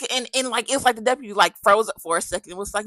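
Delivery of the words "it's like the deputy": usual speaking voice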